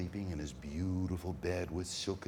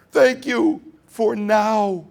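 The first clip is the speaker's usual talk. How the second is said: high, loud, ecstatic thanks; overjoyed thanks; 12 semitones higher, 14 dB louder, much slower than usual. Acted. Boy thrilled he finally shuddered, loud joyful thanks. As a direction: burst out with delight. Gratitude builds to a cry.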